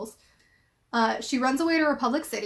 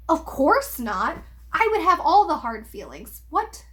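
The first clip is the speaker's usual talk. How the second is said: exasperated disbelief, pitch swooping up; indignant and baffled, ending on a high note; incredulous; 4 semitones higher than usual. Real, not acted. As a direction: echo it as if you can't believe your ears. Mock disbelief at the quoted reply.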